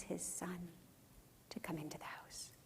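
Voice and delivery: hushed, breathy whisper; preacher whispering the father's plea. Real, not acted. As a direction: drop to a pleading whisper. Father begging son, tender climax.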